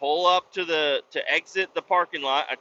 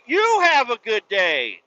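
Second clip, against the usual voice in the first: slow, raised, pointed retort; mean send-off; 6 semitones higher, 4 dB louder, slower than usual. Retold or imitated. Driver returns the cop's goodbye, trying to sound mean. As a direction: throw the pleasantry back with a stretched-out, mean edge. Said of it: "I tried to say it in a mean way".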